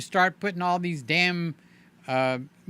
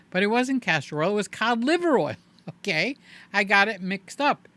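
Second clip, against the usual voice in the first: usual speaking voice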